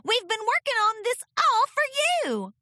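high, loud eager filly. Acted. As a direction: proudly show your work. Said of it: "asked Apple Bloom".

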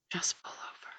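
hushed, breathy urging; coaxing whisper. Acted. Narrator tempting herself to pull the car over, coaxing whisper.

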